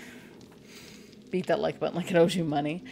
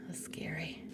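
whispering; 14 dB quieter than usual. Real, not acted. Whisper after the scare.

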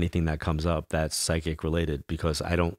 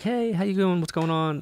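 fake cheer; 13 semitones higher than usual. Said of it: "I'm like".

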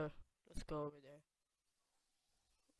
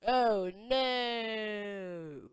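long, loud, drawn-out wail; mock dismay; 4 semitones higher, 6 dB louder than usual. Player reacts to being locked in the room, mock dismay. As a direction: cry out in hammed-up dismay.

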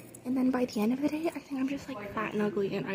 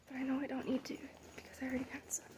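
whispering; 11 dB quieter, faster than usual. Real, not acted. Quiet talk in school hallway.

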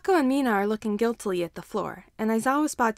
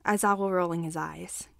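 plain reading voice

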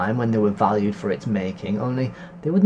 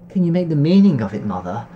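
excited; 4 semitones higher, 6 dB louder than usual. Acted. Excited girl asking her mother.

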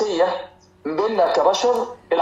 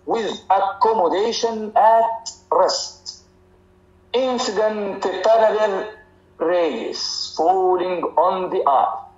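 very slow, word by word, like dictating to a class; measured dictation of a textbook definition; much slower than usual. Shown as a demonstration. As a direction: spell the definition out slowly so students can copy. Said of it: "تقول لي".

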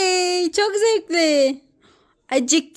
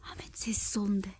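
quick hushed whisper; whispering while hiding; 9 semitones lower, 16 dB quieter than usual. Pretend. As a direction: shush them quickly. Hiding, shushing.

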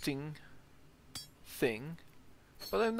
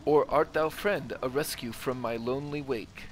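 theatrical, declaiming the verse with feeling; lightly acted stage reading of a tragic play; dramatic play reading; 6 dB louder than usual. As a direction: read it like a stage tragedy, lines savoured. Recites play lines to statue.

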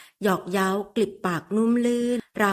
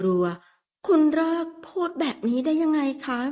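flustered protest; 8 semitones higher, slower than usual. Acted. Embarrassed woman scolds him.

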